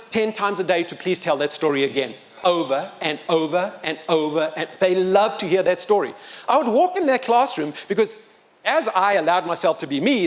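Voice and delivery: normal talking voice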